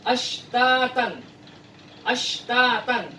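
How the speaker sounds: "Ashtatan" very slow with a clear sh; slow Quranic reading; slow shin reading. Shown as a demonstration. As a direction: draw out "Ashtatan" with sh not s. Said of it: "huruf shin jangan dibaca lagi seperti huruf sin".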